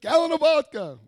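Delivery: put-on high, loud drunken order; drunken shout. Drunken order shouted at drive-through.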